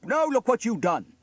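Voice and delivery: high, loud reproach; upset cry. A narrator's reading. Trevor scolds Elvis for the drum, crying out. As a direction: cry out over the damage. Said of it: "cried Trevor".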